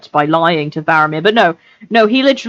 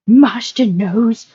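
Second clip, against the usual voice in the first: slow, higher creature voice; Gollum, wheedling; 6 semitones higher, much slower than usual. Acted. Creature turning to his master, slow wheedling Gollum. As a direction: draw it out, appealing to your master.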